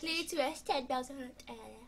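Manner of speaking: high, mocking imitation of a friend; mocking mimicry. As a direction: parrot him back in a mocking voice. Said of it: "mimicking friend's request".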